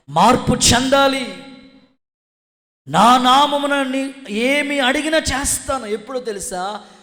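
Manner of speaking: loud, high urging; full-force preaching. Real, not acted. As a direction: build to a shouted proclamation. (గొంతెత్తి ప్రసంగం)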